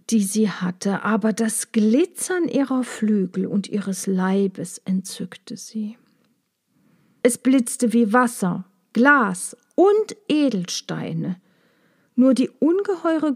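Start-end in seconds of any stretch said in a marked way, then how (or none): none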